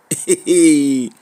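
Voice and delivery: low, rippling laugh; low chuckle. Real, not acted. Speaker amused at own accent slipping, low chuckle. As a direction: laugh low in short pulses.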